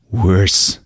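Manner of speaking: usual speaking voice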